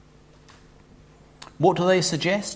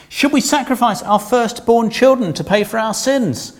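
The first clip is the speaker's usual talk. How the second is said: higher, louder stroppy protest; sarcastic whine; 7 semitones higher, 5 dB louder than usual. Pretend. Israel answering God sarcastically, higher and louder. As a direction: throw it back at God like a teen. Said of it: "God is so mean".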